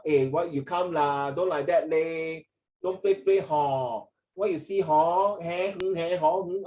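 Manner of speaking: slow Singlish with stretched particles. Shown as a demonstration. Tutor showing students what not to say, drawn-out Singlish particles. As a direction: drag out lah, leh and hor in demonstrated Singlish. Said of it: "But this is Singlish".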